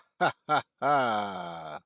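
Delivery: put-on comedienne cackle; a hammed-up signature cackle; Phyllis Diller cackle. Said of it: "There's my, uh, Phyllis Diller impersonation".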